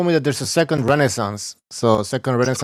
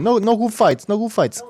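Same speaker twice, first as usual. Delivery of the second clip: put-on playful Cuban accent, pitched up; 8 semitones higher than usual. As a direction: slip into a joking Cuban accent. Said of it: "Why am I talking like a Cuban?".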